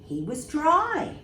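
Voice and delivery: plain reading voice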